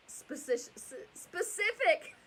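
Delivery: laughing through a stumbled word; tongue-tied laugh. Real, not acted. Mother tripping on her words, laughing. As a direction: giggle while fixing the slip. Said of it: "I am so tongue-tied".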